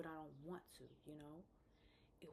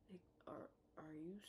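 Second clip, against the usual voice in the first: usual speaking voice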